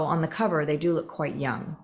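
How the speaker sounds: usual speaking voice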